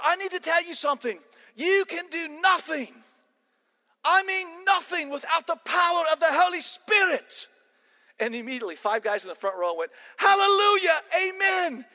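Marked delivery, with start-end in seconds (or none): 0.0-7.5 high, booming Scottish shout; impassioned Scottish roar; fiery Scottish accent. Acted. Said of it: "started to get really fired up". 10.2-11.8 high, loud congregational cry; mock-fervent high shout; exuberant shout. Students call out in the story.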